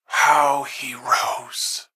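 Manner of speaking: breathy slow whisper, savoring it; whispered and drawn out. Acted. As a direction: hush it out like a dark secret. Villain musing on a rise.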